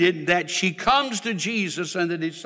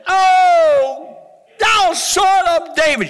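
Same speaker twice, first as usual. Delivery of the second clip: high, loud pleading shout; Bartimaeus crying out; 10 semitones higher, 7 dB louder, slower than usual. Acted. Blind Bartimaeus shouting to Jesus over the crowd, high and loud. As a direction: call out over the crowd, begging. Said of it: "Blind Bartimaeus said".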